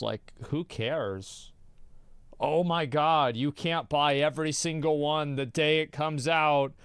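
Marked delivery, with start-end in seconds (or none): 2.4-6.7 mock hysterical completionist. Streamer mocking completionists who must get everything, hysterical mock panic. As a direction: parody a panicked player, stammering louder and faster.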